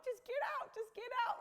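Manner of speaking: very high, frantic mock plea; squeaky-high, hurried begging; retold desperate urging. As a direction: re-enact shooing people out in a panicked high voice. Retells urging gardeners out.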